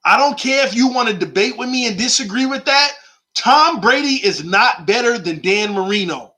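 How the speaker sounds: normal talking voice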